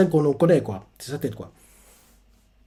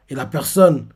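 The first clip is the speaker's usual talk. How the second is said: usual speaking voice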